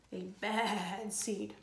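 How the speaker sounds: slow quiet ominous echo; spooky echo. A narrator's reading. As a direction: let it hang darkly.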